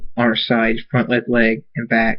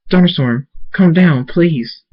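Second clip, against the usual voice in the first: higher, pleading voice; urgent plea; 6 semitones higher, slower than usual. A narrator's reading. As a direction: beg him to calm down. Twilight pleads with angry Thunderstorm.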